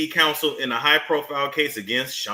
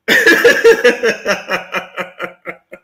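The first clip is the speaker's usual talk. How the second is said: loud laugh; 3 semitones higher, 7 dB louder than usual. Real, not acted. Laughing at the joke, loud laugh. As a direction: burst of laughter.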